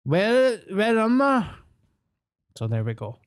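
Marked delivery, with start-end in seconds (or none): 0.0-1.5 confused just woken. Retold or imitated. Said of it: "And I'm like".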